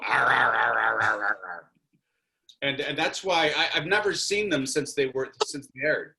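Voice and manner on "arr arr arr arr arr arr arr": gruff, louder pirate growl. Retold or imitated. Actor warms up his pirate noise on set. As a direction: growl pirate noises before each take. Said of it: "Okay, go".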